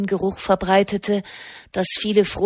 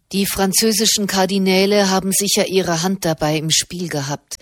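ordinary delivery, nothing marked

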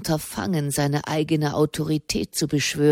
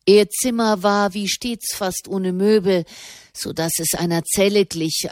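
ordinary delivery

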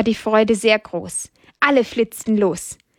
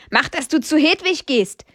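higher-pitched, scolding rooster; mildly cross telling-off; 6 semitones higher than usual. A narrator's reading. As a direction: order him over sternly. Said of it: "schimpft Amadeus".

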